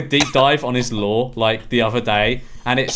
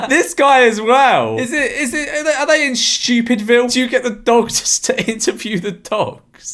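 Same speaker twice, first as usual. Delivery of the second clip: high, laughing disbelief; 9 semitones higher, 4 dB louder than usual. Real, not acted. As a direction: giggle through the question. Laughing at absurd question.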